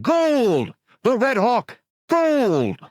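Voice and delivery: high, loud chant of the band; bellowing crowd. Acted. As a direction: shout it like a mob. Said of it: "they bellowed".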